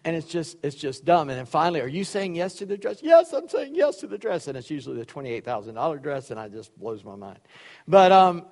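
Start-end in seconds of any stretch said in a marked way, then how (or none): 1.8-3.0 mock TV host's question, pitched up; posed question. Host to bride on dress show, leading question. 3.0-4.5 mock-excited squeal, pitched way up; gushing bride. Bride answering on dress show, hammed-up high excitement.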